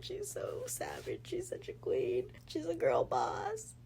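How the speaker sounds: crying. Real, not acted. Fan praising the book's couple, tearfully crying. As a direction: gush through tears, pitch climbing. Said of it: "Why am I still crying?".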